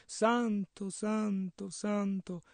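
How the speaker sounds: very low, hushed, spaced-out words; just saying it softly. Acted. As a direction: say it small and soft. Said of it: "non dicevano solo". (detto piano, sottovoce)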